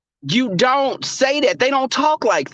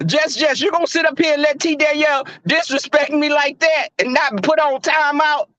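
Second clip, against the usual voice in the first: higher-pitched indignant complaint; indignant call-out; 7 semitones higher than usual. Real, not acted. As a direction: take the moderator to task in a raised pitch. Calling out a chat moderator.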